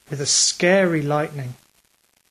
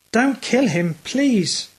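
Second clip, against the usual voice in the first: higher, pleading frightened cry; tearful shout; 7 semitones higher, slower than usual. A narrator's reading. Lillian begs the man to spare Ralph, tearful cry. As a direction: beg him in tears. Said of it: "shouted Lillian".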